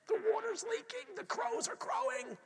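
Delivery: high, mocking frazzled voice; exasperated parent. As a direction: act the overwhelmed dad.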